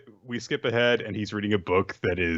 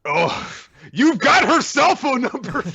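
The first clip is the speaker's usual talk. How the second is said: high, slow, exasperated cry; exasperated squeal; 4 dB louder, slower than usual. Real, not acted. Friend yelling at the anime character in a high exasperated squeal. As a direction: squeal at him for being dense.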